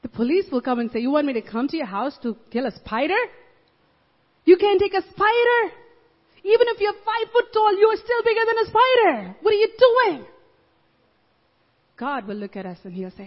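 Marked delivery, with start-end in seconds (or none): 1.0-10.2 incredulous cop. Retold or imitated. Police officer scolding caller.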